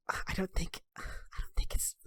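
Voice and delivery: hesitant whisper. Acted. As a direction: breathe it out, unsure. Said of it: "And Faith says".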